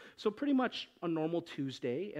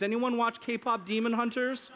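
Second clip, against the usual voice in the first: ordinary talk, nothing marked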